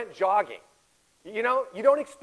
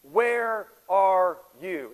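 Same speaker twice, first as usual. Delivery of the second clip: slow, drawn-out plea; a slow, aching question to God; pleading cry to God; 5 dB louder, much slower than usual. Retold or imitated. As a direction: stretch it out like a lost soul asking. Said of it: "the question everybody asks".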